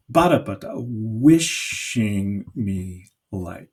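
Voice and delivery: "Bada bada" with the stress up front, then slow; trochee beat. Shown as a demonstration. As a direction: chant the trochaic rhythm. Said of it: "it goes".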